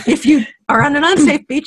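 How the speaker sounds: laughing through the words, pitch up; giggling over the grim image; laughing while talking. Real, not acted. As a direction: let a laugh break into the sentence. Laughing through an ocean warning.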